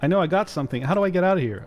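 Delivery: ordinary talk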